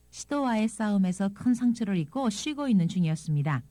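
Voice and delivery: normal talking voice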